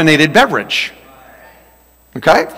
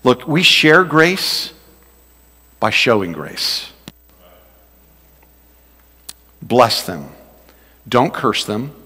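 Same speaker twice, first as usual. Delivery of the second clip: earnest appeal; 6 semitones lower, 6 dB quieter, slower than usual. Real, not acted.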